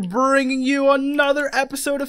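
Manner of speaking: loud, high, drawn-out video opener; hyped intro. Real, not acted. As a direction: belt out a show welcome, stretching the key words. Host's show-opening greeting.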